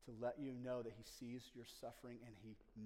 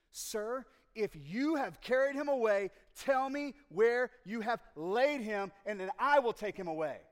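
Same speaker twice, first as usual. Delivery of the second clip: angry demand; 10 semitones higher, 17 dB louder than usual. Acted. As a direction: shout it at the gardener in desperate anger. Mary demanding of the gardener.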